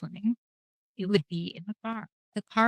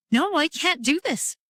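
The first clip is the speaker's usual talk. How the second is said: higher, slow, drawn-out protest; retold refusal; 7 semitones higher, slower than usual. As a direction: stretch out the refusal, pitch lifted. Retelling her refusal.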